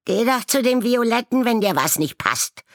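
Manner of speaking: very high, loud fast jeer; quarrelling raven. A narrator's reading. Pink raven jeering at a rival flock, very high and loud. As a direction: tell the rival off. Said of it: "aus einem rosaroten Schwarm hören".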